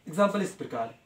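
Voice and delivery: ordinary delivery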